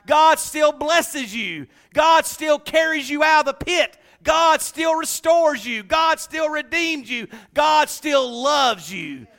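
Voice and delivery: slow, loud preacher's refrain; preaching at full force. Acted. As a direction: hammer each line home to the church. Preaching climax, God still refrain.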